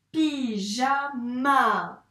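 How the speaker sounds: "Pyjama" drawn out very slowly, each syllable joined; stretched slow blend. Shown as a demonstration. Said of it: "on va faire syllabe par syllabe".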